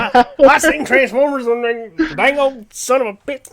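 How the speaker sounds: put-on high, loud Southern-accented ramble; grumble-rant in a thick Southern accent; mock Southern outrage; Boomhauer. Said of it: "turn into borderline Boomhauer from Hank of the Hill".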